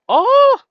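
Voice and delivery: sudden loud, high "Oh!"; surprised cry. Real, not acted. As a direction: burst out with a high cry of realisation. Sudden realisation during word puzzle.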